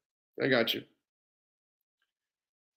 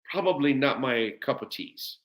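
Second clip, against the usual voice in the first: ordinary talk, nothing marked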